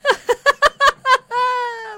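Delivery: loud, high burst of real laughter; high shrieking laugh. Real, not acted. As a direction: crack up hard at the clip. Duck clip just played.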